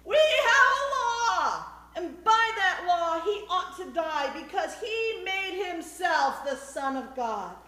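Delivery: very high, loud declamation, first words stretched; high shouted accusation; shout at full lungs. A narrator's reading. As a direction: proclaim it at the top of your voice. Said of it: "shouted at the top of his lungs".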